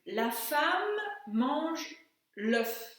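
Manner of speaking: sentence dictated slowly, word by word; teacher dictates a sentence for translation, slowly; slow dictation. Shown as a demonstration. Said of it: "voyons si vous êtes capable de traduire".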